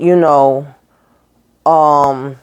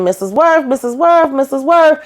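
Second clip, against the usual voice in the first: calling the name ever higher and more urgently; calling out, rising; 8 semitones higher than usual. Retold or imitated. Mother in the locked basement calls Missus Worth, rising. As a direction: call for Missus Worth through the locked door. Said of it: "she's knock, knocking, knocking".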